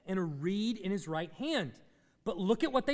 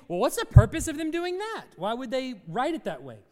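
higher, louder puzzled question; imagined questioner; 3 semitones higher, 6 dB louder than usual. Acted. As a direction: voice a listener's raised objection, loud at first. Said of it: "you might think".

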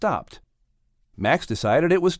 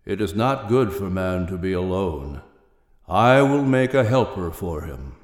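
deep divine voice; 6 semitones lower than usual. Acted. Voice of the Maker.

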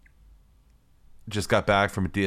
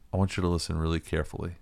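low, quiet, fast cop interrogation; serious cop voice; 3 semitones lower, 7 dB quieter than usual. Retold or imitated. Cop questioning a suspect across the street, quiet and serious. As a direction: lean in and grill him quietly like a stern cop. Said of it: "a really serious voice".

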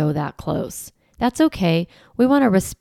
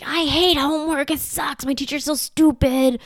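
higher, mocking a kid who hates homework; fed-up older kid; 7 semitones higher than usual. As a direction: play the sulky student griping. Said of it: "they're like".

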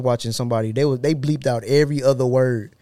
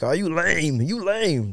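mocking partygoers; 4 semitones higher, much slower than usual. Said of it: "people get mad at me".

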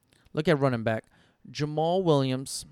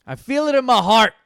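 much higher, much louder heartfelt repeat; emphatic; 7 semitones higher, 9 dB louder than usual. Real, not acted. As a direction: say it like a bold declaration.

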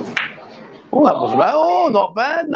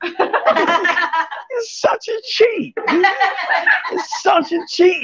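slow, laughing mock outrage; laughing accusation; 5 semitones higher, much slower than usual. Real, not acted. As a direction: laugh it out while calling her a cheat. Catching a player cheating.

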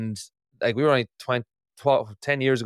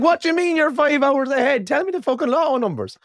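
high-pitched, exasperated mock demand; indignant, high, hammed-up disbelief; mock incredulous outburst; 13 semitones higher than usual. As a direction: act out comic disbelief, pitched way up. Retold incredulous childhood demand.

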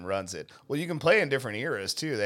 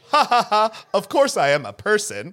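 higher, louder fake-cheerful robot; robocall voice; 8 semitones higher, 8 dB louder than usual. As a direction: play a chirpy phone robot. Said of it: "the robot responds with".

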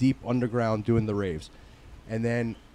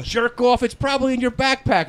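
mock angry retort; 9 semitones higher than usual. Acted friends' quarrel over tent.

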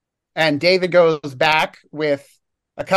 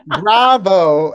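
sarcastic; 4 semitones higher, 5 dB louder than usual. Real, not acted. Sarcastic praise of gory torture.

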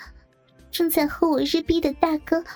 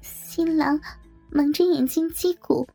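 much higher, halting, distressed voice; pained; 6 semitones higher than usual. A narrator's reading. The coerced bridesmaid goes on reciting rules, pained. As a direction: recite reluctantly, high and pained. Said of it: "继续痛苦地说".

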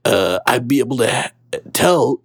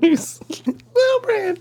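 tiny squeaky voice, much higher; squeaky Lil Brand. Acted. Host's squeaky alter ego butts in, very high squeak. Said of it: "the Lil Brand who squeaks out".

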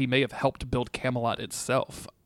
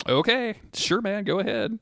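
higher-pitched acted go-ahead; pitched-up, casual mock consent; offhand agreement; 9 semitones higher than usual. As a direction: wave him on in a high voice. Acting out a reply to Merlin.

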